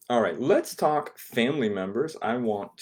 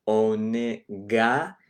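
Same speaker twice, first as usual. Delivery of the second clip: slow vowel drill; drawn-out syllables; much slower than usual. Shown as a demonstration. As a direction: stretch "Onegai" very slowly so each vowel rings. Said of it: "these vowels are very simple".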